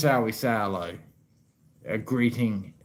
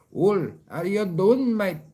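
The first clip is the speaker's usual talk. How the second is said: higher, slow, stiff outsider's "how you doing, mate"; mock foreigner greeting; 8 semitones higher, 5 dB louder than usual. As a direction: greet like a tourist who doesn't belong. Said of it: "you don't wanna be from some other country".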